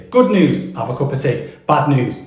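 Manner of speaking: normal talking voice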